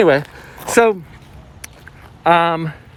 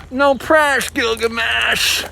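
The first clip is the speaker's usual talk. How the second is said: high, drawn-out catchphrase; hammed-up clever person; 6 semitones higher, slower than usual. Imitating really clever people, hammed-up high call. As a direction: sing out the name slowly, high-pitched. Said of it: "Really clever people would say".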